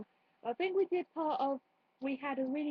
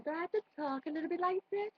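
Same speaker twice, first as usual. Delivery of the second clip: higher-pitched sing-song Welsh accent; 6 semitones higher than usual. Shown as a demonstration. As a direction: demonstrate a lilting Welsh accent. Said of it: "it's basically a Welsh accent".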